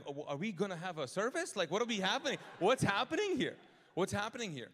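rising panic, building to a near shout; exasperated outburst. Retold or imitated. Pastor losing it at the rental counter, building loud. Overwhelmed during the rental interrogation.

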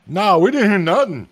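loud, high comic horse voice; high-pitched, blaring animal character; talking horse. Acted. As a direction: answer loudly as a cartoon horse. Game master answers as the horses.

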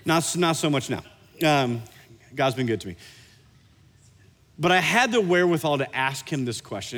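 ordinary delivery

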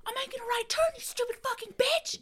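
high-pitched furious yell imitating the driver; imitating a driver screaming out his window; mock road-rage yell. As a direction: screech it like an enraged driver. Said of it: "he goes".

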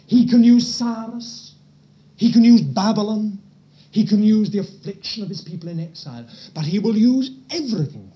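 sermon climax, strong and loud; preaching with force. Real, not acted. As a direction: drive each point home.

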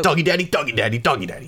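high, repeated name chant; Doggy Daddy. Acted. Ratty dude keeps introducing himself, high-pitched. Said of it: "and he's like".

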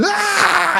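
high startled "Ah!" of a wife; wife's sudden scream. Retold or imitated. Wife screams at the rat and claws husband, high startled cry. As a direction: shriek it as she claws him. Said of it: "she said".

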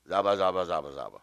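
low, monotone nonsense syllables; mock translator. Translator ignoring the coaching, mock low monotone gibberish. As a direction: imitate an unmoved translator in gibberish. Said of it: "And this is my translator".

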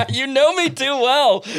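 high, drawn-out words through laughter; helpless laugh riding on the words. Real, not acted. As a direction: crack up while admitting it. Laughing at being teased.

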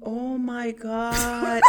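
slow laughing oh my God. Real, not acted. Recalling her blonde moment, slowly laughing it out. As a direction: dissolve into laughter at herself.

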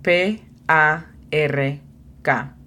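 slow, letter by letter; spelled out slowly. Shown as a demonstration. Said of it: "se pronuncia simplemente como se lee".

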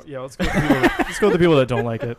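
amused, loud, laughing delivery. Real, not acted. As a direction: laugh your way through the line. Laughing while choosing who rates first.

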